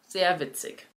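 dry, quiet "very funny"; quiet deadpan sarcasm; sarcastic. Real, not acted. Sarcastic comment after mock laugh.

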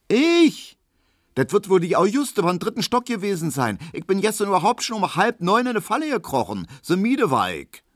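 very high, affronted protest; outraged maid clearing herself; indignant denial. A narrator's reading. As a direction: deny it hotly in a high voice. Maid loudly denies being seen.

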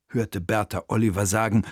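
usual speaking voice

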